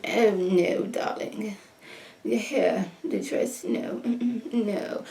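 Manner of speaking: soft, half-whispered rich mother tutting; hushed upper-class mama scolding; bougie mom voice. Retold or imitated. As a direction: purr it down at your daughter like old money. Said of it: "this bougie voice that the mom was giving".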